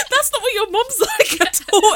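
high, drawn-out mock protest; laughing, high-pitched objection. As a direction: quote yourself protesting, high and slow. Mock protest at friend's description.

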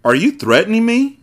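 high-pitched question climbing at the end; mock rage. A narrator's reading. Narrator voicing Zaire challenging the agent, high mock rage. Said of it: "Zaire asked in rage".

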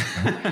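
brief amused chuckle; light laugh. Real, not acted. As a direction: laugh lightly after your own remark. Laughs after own remark.

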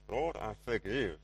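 slow, forced through clenched teeth; gritted teeth. Retold or imitated. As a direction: grudgingly squeeze out the words. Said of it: "say it through gritted teeth".

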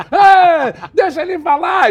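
high loud protest from the crowd; audience outcry. Retold or imitated. Audience member defends the old father, high and loud. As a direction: shout it like the angry audience.

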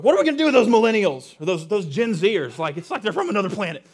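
loud mock exasperation at the young; grumbling senior. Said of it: "the senior saints will just, like, look down".

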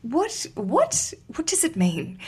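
mock baffled questioning, high then dropping; retold bewilderment with rising repeats; confused. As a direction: imitate puzzled Brits asking for meaning. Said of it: "the Brits were quite confused".

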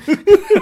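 loud, high burst of laughter; a sudden high, loud laugh. Real, not acted.

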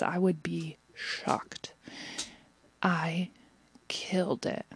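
soft, hushed boast; smug whisper. Retold or imitated. She whispers to her boss that she nailed it. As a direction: whisper the brag like a secret.

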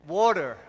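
"Water" as "war-der"; American pronunciation. Retold or imitated. Preacher repeats the States' version, American "Water". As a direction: imitate American "Water" with d and final r. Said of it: "how they pronounce it in the States".

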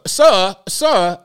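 hammed-up British accent calling out; a fan parodied in a fake British accent, calling after a tall player; mock British accent. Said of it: "she was using a British accent".

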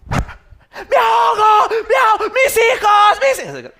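high, loud, drawn-out screams; drowning panic. Acted. Mother flailing in the pool, mock drowning screams. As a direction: shriek in hammed-up desperation.

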